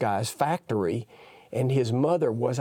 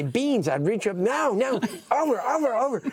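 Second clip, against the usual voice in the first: put-on higher, fussy old woman barking directions; imitating bossy old lady; 8 semitones higher than usual. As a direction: point him to the right can, impatient. Old lady directing him at store.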